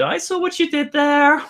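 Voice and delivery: high, teasing sing-song; playful call-out. Retold or imitated. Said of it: "I'm like".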